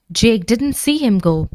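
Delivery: plain reading voice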